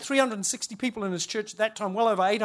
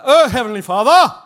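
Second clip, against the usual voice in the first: mock pious prayer voice, much louder; holy prayer voice; 11 dB louder than usual. Imitating a Norwegian host praying over dinner, loud mock holy voice. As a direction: ham up a solemn churchy invocation. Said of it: "he went into some sort of holy voice thing".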